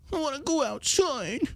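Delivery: put-on high, soft babyish whine; mock whine. Host mocking a locked-down star, high soft whine. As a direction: whimper like a spoiled child.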